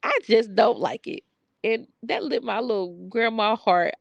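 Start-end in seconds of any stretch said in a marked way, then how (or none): 0.0-1.2 higher-pitched, emphatic imitation of a small boy; grandson standing his ground. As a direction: quote a little boy refusing to explain himself. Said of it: "But the way he said it".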